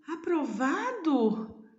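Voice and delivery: high, slow, astonished "Aprovado?" rising up high; surprised question. Shown as a demonstration. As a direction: read it like a boy who can't believe he passed. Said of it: "Ele ficou tão surpreso". (pergunta surpresa, aguda e lenta)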